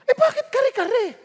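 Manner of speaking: high, loud indignant question; annoyed husband. Retold or imitated. Husband to wife over wrong dish, loud annoyed. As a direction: demand why it's the wrong dish. Spouse quarrel over dinner.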